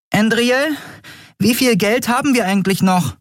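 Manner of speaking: very high, dainty character voice; delicate little voice. A narrator's reading. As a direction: play a tiny companion with a delicate, high-pitched voice. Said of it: "ihre zarte Stimme". (zarte, sehr hohe Figurenstimme)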